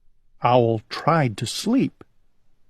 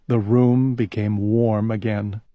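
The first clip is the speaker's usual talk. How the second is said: plain reading voice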